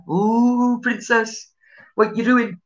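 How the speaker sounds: a fond Scottish uncle teasing his niece. Retold or imitated. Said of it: "he was proper Scottish".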